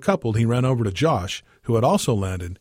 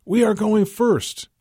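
high, slow shouted call over the engine; yell; 11 semitones higher, 4 dB louder, slower than usual. A narrator's reading. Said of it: "Abe's instructor yelled".

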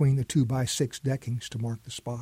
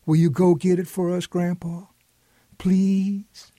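put-on higher, louder little-girl plea; pleading voice; 5 semitones higher, 4 dB louder than usual. A narrator's reading. Teresa begging grandpa to fetch the watch, pleading voice. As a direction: beg sweetly. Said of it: "in her best pleading voice".

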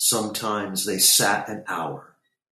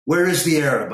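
raised, higher angry question; angry demand; 7 semitones higher, 7 dB louder, faster than usual. A narrator's reading. Boy standing up to his angry uncle, angry. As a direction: demand it, cross and insistent. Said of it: "I was angrier at him".

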